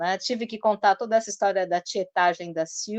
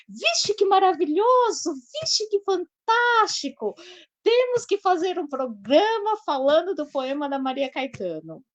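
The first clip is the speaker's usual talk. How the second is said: much higher, slow, swooning gush; imitating gushing fan; 9 semitones higher, 4 dB louder than usual. Host imitating Silvia raving about the poem, high slow gushing. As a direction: stretch it out like a starstruck admirer. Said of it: "não expressei com toda a entonação de voz dela".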